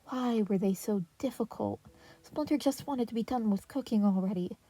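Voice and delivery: plain reading voice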